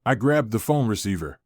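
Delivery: usual speaking voice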